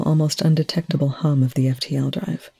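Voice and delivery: ordinary delivery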